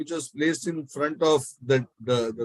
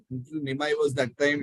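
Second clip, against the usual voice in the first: plain reading voice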